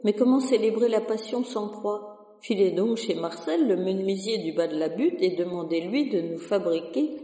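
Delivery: a harried curé scolding the sacristan, rushing him off; flustered priest. Acted. Curé scolds the sacristan about the burnt cross, rising to frantic panic.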